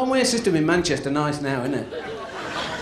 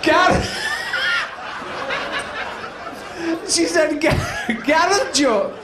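put-on high, dreamy old lady stumbling on the name; high old woman; 10 semitones higher, slower than usual. Old woman fumbling the name of her beau. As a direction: trip over the name fondly.

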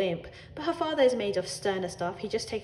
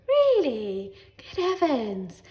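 higher, louder, drawn-out amazement; feigned astonishment; 5 semitones higher, 6 dB louder, much slower than usual. Acted. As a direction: put on shocked politeness.

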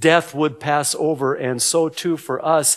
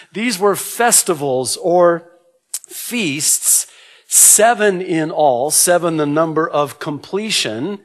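normal talking voice

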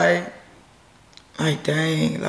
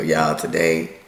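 much lower, slow announcer voice; mock deep voice; 13 semitones lower, slower than usual. Wife introducing today's video in a mock deep announcer voice. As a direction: drop it down like a movie trailer.

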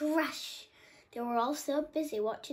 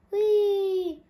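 loud high cry; lion roars as the monkey runs; roared; 8 semitones higher, 12 dB louder, much slower than usual. A narrator's reading. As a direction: roar after the stolen key. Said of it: "roared Mortis".

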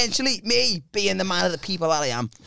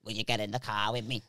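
low, very soft voice; Chris; 11 semitones lower, 14 dB quieter than usual. Retold or imitated. Friend asking for company on the drive, low soft voice. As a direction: murmur the request down low. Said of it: "it's just how he speaks".

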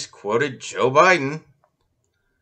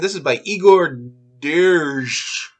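ordinary talk

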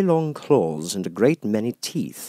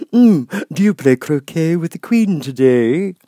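grinning Cheshire Cat; 9 semitones higher, 10 dB louder than usual. Acted. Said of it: "said the cat".

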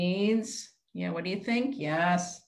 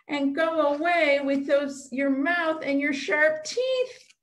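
mock stern shooing; 8 semitones higher than usual. Teacher leads the kids in shooing away the puppet monster. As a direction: chase the puppet monster off, playful but firm.

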